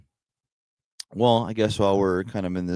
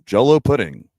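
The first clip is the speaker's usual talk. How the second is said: loud, higher mock Bill Cosby voice; Bill Cosby impression; 6 semitones higher, 10 dB louder than usual. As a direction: belt out a hammed-up Cosby ad line. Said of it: "is Bill Cosby".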